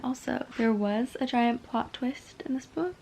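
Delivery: whispered secret about the plot twist; breathy conspiratorial whisper. Real, not acted. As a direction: lean in and whisper it.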